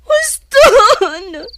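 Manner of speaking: sudden cry out. Acted. Estela cries out in distress.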